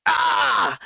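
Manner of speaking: high acted "ah" of overload; overload cry. Parent in an overload moment, acted high outcry. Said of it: "those moments where we go".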